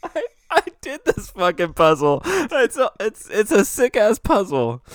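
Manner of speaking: high, laughing protest; squeaking through laughter. Real, not acted. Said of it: "He's crying".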